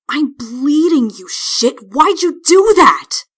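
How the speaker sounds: loud, slow indignant demand; angry demand. Acted. Princess cut by her teacher, slow angry demand. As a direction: demand an answer, furious.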